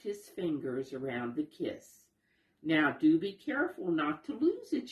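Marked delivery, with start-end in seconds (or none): 2.6-4.9 light, quick playful warning; teasing. Acted. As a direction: tease him gently, a little faster and higher. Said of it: "she teased him".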